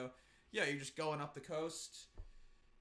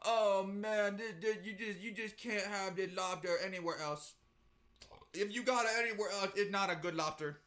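loud, stammering mock tourist; hammed-up insistent tourist voice; tourist asshole; 3 semitones higher, 4 dB louder than usual. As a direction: gush like an obnoxious tourist. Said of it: "It's some tourist asshole".

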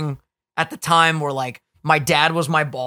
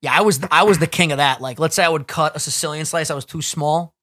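usual speaking voice

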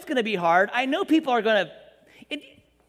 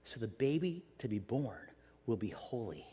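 much softer, lower, half-whispered angel; hushed angel; 12 semitones lower, 12 dB quieter than usual. Retold or imitated. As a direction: hush it like a sacred secret. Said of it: "the angel's like".

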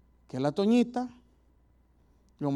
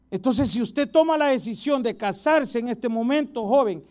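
forceful preaching; 4 semitones higher, 7 dB louder than usual. Acted. Preaching loudly.

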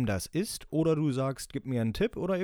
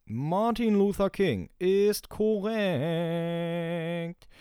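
quizmaster announcement; 4 semitones higher, 3 dB louder, much slower than usual. Pretend. Host confirms answer like quizmaster.

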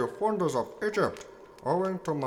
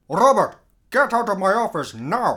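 angry; 2 semitones higher, 7 dB louder than usual. Acted. President orders Robert out.

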